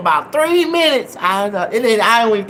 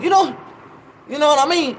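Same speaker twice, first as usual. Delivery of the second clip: high, sing-song grandma; 8 semitones higher than usual. Retold or imitated. Grandma asking the crowd, high mock voice. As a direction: chirp it like an old grandma. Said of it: "She said".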